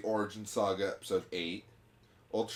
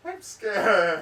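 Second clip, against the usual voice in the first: higher, louder scared cry; scared whine; 8 semitones higher, 7 dB louder than usual. Acted. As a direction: wail it like a scared kid. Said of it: "having a little panic attack".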